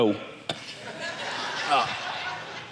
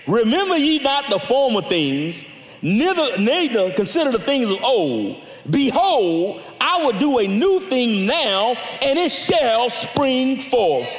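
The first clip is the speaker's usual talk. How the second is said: rising preacher's reading of Isaiah; scripture reading; 4 semitones higher than usual. Acted. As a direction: proclaim the verses, building to a shout. Reads Isaiah aloud.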